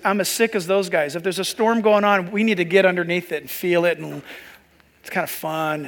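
ordinary talk

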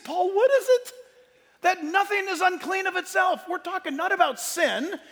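high-pitched, emphatic preacher's delivery; fired-up preaching. Real, not acted. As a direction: preach it with rising fervor, hammering the point.

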